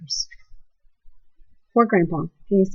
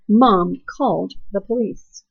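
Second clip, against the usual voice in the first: ordinary delivery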